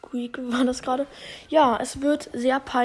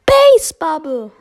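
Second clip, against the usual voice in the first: put-on very loud, slow, higher bat-girl call; Bibi voice line; 4 semitones higher, 12 dB louder than usual. Imitating Bibi's line for viewers, very loud and slow. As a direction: stretch it out like a sassy batter.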